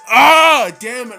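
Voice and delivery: ordinary talk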